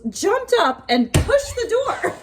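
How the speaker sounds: breaking into laughter while telling, high; laughing. Real, not acted. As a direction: giggles take over the punchline. Laughing at funny cat story.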